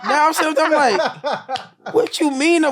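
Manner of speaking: high-pitched, half-laughing disbelief; retold incredulous protest. Patient told he's going upstairs, high incredulous protest.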